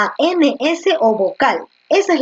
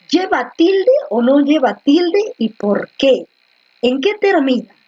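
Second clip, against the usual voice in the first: ordinary delivery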